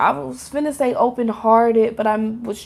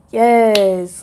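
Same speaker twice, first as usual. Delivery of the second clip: firm, loud mock cheer of approval; acted crowd approval; 5 dB louder than usual. People reacting when you pop out, emphatic approval. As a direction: punch out a loud yes as an admiring crowd. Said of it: "people, it's like".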